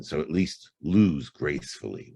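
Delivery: normal talking voice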